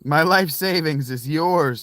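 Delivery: theatrical lovestruck fan; mock swooning. Real, not acted. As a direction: melt over the voice in a slow, hammed-up way. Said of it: "Just do that voice".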